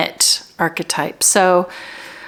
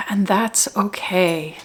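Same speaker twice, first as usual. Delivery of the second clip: spacious, measured meditation leading; guided meditation; 3 dB quieter than usual. Real, not acted. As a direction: guide them gently into their center, unhurried. Leads guided meditation, slow with pauses.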